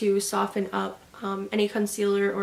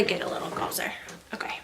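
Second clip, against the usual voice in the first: whisper; 3 semitones lower, 10 dB quieter, much faster than usual. Real, not acted. Leans close to camera.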